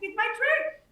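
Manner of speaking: high squeaky reprise; squeaky proud reprise. Retold or imitated. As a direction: repeat it up high, beaming. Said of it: "And I was like".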